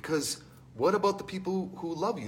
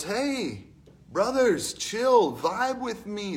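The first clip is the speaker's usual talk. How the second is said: laid-back surfer Socrates; 6 semitones higher, much slower than usual. Retold or imitated. Socrates voiced as laid-back dude.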